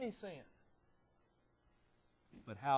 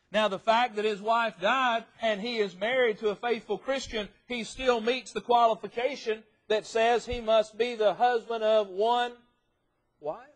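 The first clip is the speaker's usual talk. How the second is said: loud, raised pulpit delivery; forceful preaching; 9 semitones higher, 13 dB louder than usual. Real, not acted. Preacher defending a remarried widower's qualification, forceful preaching. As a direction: press the argument home from the pulpit.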